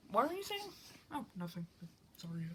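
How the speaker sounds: ordinary delivery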